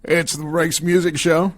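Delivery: bright announcer read of the show name; radio host intro. Acted. Host opening his radio show, upbeat announcer voice.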